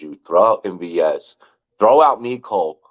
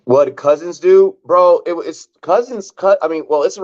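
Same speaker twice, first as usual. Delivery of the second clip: excited; 9 semitones higher than usual. Real, not acted. Outraged reaction.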